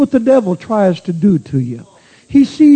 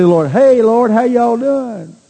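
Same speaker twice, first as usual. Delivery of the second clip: drawn-out, higher mock greeting to God, slowed; overly chummy hello to the Lord, mocked; mock casual greeting; 5 semitones higher than usual. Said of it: "when you say".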